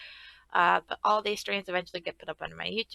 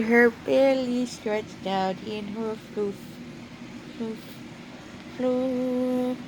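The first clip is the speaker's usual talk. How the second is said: cooing over the cat; 3 semitones higher, 5 dB louder, much slower than usual. Real, not acted. Cooing about the cat on her.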